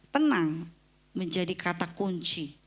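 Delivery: usual speaking voice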